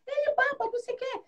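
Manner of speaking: put-on high-pitched tattling child voice; squeaky-high mock child teasing; mocking little girl. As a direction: imitate a little girl complaining to mom. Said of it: "a menininha começou a dar risada".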